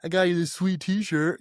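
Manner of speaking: high whiny imitation; mock quitter to mom. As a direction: mimic the quitter handing mom a gift. Mocking quitter's gift to mom.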